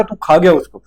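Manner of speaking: ordinary talk, nothing marked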